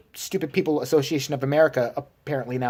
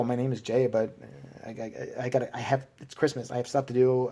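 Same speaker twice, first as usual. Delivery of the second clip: flustered mumble; 6 semitones lower, 8 dB quieter than usual. Retold or imitated. Flustered excuse to cop.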